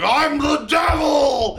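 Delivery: high, drawn-out mock holler; hammed-up maniacal shout; mock demonic yell. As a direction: bellow it slowly like a crazed braggart. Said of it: "literally went around going".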